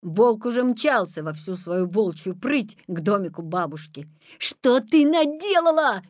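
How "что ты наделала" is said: high, loud cry of reproach; raised, scolding boy's shout; alarmed shout. A narrator's reading. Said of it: "крикнул Петя".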